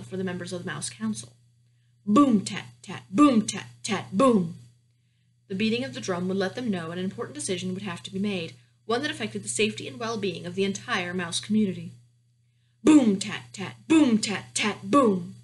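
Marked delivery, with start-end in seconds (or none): 2.0-4.6 loud booms, lighter staccato tats; slow drumbeat. A narrator's reading. Mouse beats the council drum, booming then tapping. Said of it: "one long beat with his tail". 12.8-15.3 loud booms, lighter staccato tats; slow drumbeat. A narrator's reading. Mouse beats the council drum, booming then tapping. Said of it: "one long beat with his tail".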